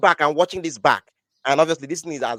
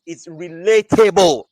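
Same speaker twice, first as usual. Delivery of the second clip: "relatable" drawn out and much louder; emphatic RELATABLE; 10 dB louder, slower than usual. Real, not acted. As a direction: punch "relatable" slowly, as the word finally found. Lands on the word he sought.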